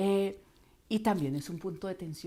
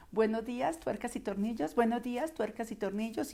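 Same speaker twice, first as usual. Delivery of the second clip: higher, sing-song receptionist greeting; routine phone-greeting voice; 4 semitones higher than usual. Acted. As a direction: recite it like a switchboard operator. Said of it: "uno oye esta voz todo el tiempo".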